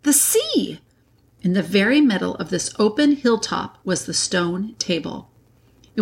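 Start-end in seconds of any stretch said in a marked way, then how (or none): 0.0-0.8 high, voiced mock whisper of wonder; excited whisper. A narrator's reading. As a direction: exclaim it as a boy's hushed discovery. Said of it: "whispered Peter to Susan".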